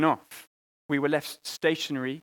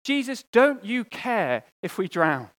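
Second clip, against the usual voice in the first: desperate cry; 5 semitones higher, 5 dB louder, slower than usual. Retold or imitated. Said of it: "the disciples wake Jesus up".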